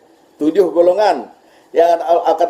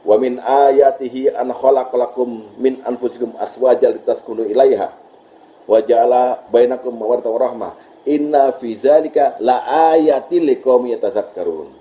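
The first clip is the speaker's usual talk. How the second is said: Quran recitation; 4 semitones lower than usual. Shown as a demonstration. Teacher reciting a verse to the class, chanted. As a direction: recite the Arabic verse reverently.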